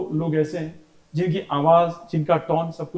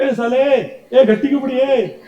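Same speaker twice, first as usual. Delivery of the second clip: higher, louder mock scolding; mock yell; 7 semitones higher, 5 dB louder, slower than usual. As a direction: yell like the quarrelsome kid. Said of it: "ऐसे कहा".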